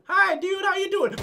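cheery fake welcome shouted; mock friendly greeting. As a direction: greet him like a pal before the ambush. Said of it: "I'm gonna pretend like I'm friendly".

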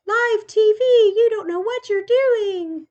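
high, loud, slow put-on scolding voice; mock announcer scolding. Scolding herself for fumbling on live TV, mock silly voice. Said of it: "Sorry, guys".

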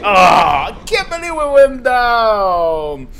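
slow, loud cry of disbelief; dismayed wail. Real, not acted. Gamer as his run suddenly ends, dismayed wail. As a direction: wail it out, stretching the words.

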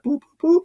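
high sung beeps for dial tones; phone dialing beeps. Retold or imitated. Hamilton dials the king, high mock phone beeps. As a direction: chirp out the keypad tones. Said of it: "picks up the phone and is like".

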